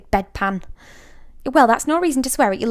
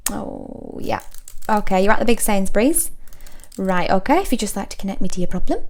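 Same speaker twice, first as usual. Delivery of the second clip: ordinary talk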